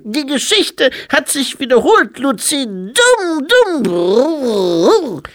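very high, loud infant voice; squeaky toddler speechifying loudly; baby-like abbot. A narrator's reading. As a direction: play a dignified abbot in a baby's piping voice.

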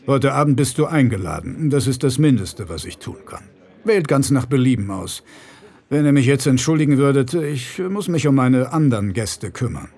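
a powerful man's cold politeness; bear-like rich man. Acted.

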